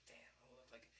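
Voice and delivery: breathy aside to himself; whisper. Retold or imitated. Child watching him, he whispers to himself. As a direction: whisper it, embarrassed. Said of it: "And I'm like".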